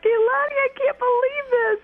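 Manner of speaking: very high, loud, tearful squeal; almost crying with happiness; overwhelmed disbelief. Retold or imitated. Newly crowned winner on the phone, high tearful joy.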